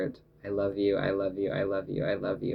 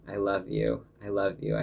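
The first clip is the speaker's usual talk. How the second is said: usual speaking voice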